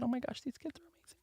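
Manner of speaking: hushed amazed whisper; whispered awe. Retold or imitated. Admiring the kids' paper, a hushed whisper. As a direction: breathe it out in awe.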